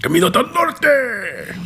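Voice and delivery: high, stretched-out mock Mexican cry. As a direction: hold the last word long in an exaggerated Mexican accent. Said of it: "tries to add the accent to that".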